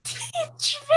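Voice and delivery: very high, soft drawn-out plea; mock entranced plea. Player in a trance begging, high mock plea. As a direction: squeak it out softly, stretched.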